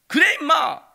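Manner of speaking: high, loud swagger; boastful shout. Retold or imitated. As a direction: crow it proudly. Son boasting.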